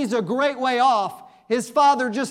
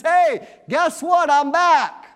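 loud, high shout with drawn-out words; big, slow comeback announcement; calling out; 6 semitones higher, 5 dB louder, slower than usual. Acted. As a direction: holler it to people who thought you were gone. Acts out Jonah surprising his shipmates.

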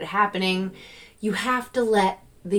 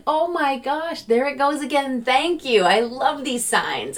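high, gushing thank-you to the universe; emphatic thanks; 7 semitones higher than usual. Retold or imitated. Driver thanking the universe for a turkey sign, emphatic and high. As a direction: burst out with delighted gratitude. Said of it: "emphatic about it".

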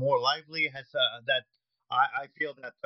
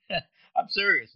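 laughing; 7 semitones higher, 4 dB louder, much slower than usual. Real, not acted.

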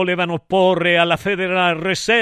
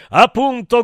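loud, higher exclamation; short raised cry; shouted; 4 semitones higher, 6 dB louder than usual. A narrator's reading. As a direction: shout agreement from the crowd. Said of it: "gridò un altro".

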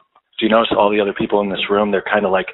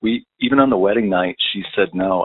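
ordinary talk, nothing marked

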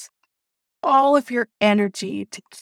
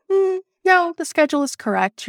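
higher-pitched imitation of a manager saying no; voiced manager, pitched up, refusing; retold dismissive refusal; 9 semitones higher than usual. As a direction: brush it off in a raised, mock-official voice.

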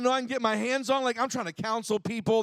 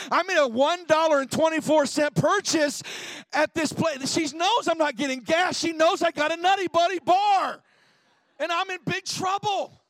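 high, louder panicked rush; rising panic; 6 semitones higher, 5 dB louder than usual. Retold or imitated. Pastor to congregation, climbing into mock panic about his wife.

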